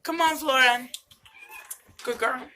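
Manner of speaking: loud call across the room; calling the cat. Real, not acted. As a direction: shout the cat's name so she comes.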